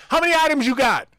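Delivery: loud and high, shouting at a customer; mock loud shop clerk; loud tone-deaf guy. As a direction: bellow a friendly greeting too loud. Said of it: "He talks to me like this".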